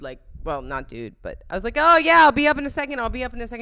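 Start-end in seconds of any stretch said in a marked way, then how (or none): 1.8-3.6 higher, louder, fast retold reply; hurried brush-off. Sister putting off her brother, a hurried brush-off. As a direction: quickly wave someone off, raised pitch.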